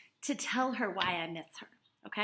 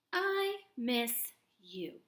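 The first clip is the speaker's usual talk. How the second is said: slow read; much slower than usual. Shown as a demonstration. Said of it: "slow model read".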